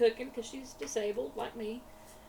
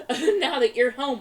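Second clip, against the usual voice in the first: giggling while teasing him; amused retelling, laughing; 3 semitones higher, 12 dB louder, slower than usual. Retold or imitated. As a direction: laugh through the words, slowing down. Teasing husband over texts.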